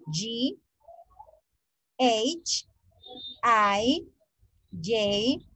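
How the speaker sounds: each letter said slowly with its English name, one by one; English alphabet recital. Shown as a demonstration. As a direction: spell out A to Z in English letter names, pausing between them. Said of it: "yo debo de pronunciarlo de esta manera". (recitar el abecedario en inglés, letra por letra)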